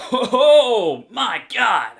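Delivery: loud, slow, drawn-out shriek of awe; imitating a fan screaming in awe; mock ecstatic cheer. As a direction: overdo the amazed fan scream. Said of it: "how they think they react".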